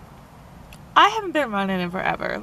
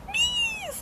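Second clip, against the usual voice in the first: thin high little call to a cat; high-pitched cat call; 8 dB quieter than usual. Retold or imitated. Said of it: "he likes this high pitched".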